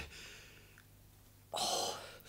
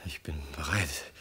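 hushed, resigned whisper; voiceless whisper of a doomed man; whispered confession; 3 semitones lower, 5 dB quieter than usual. Acted. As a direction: breathe it out like a final admission. Condemned man ready for execution.

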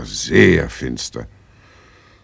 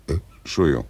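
burping; much faster than usual. Acted. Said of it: "Warum rülpsen Sie denn fortwährend so ekelhaft".

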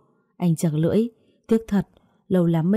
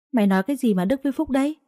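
higher, fast and indignant; angry outburst; 7 semitones higher, faster than usual. Acted. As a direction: she snaps back fast, high and offended. Angry quarrel between mother and neighbour.

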